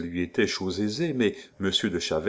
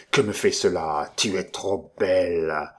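growling brute. Acted. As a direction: savour it like a beast. Duke lusting, growling.

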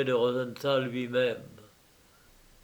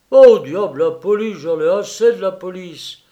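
loud, fed up; exasperated outburst; 5 semitones higher, 11 dB louder than usual. Acted. Rosenthal snaps back at Purvis, loud and fed up. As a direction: blow up in frustration. Quarrel reply.

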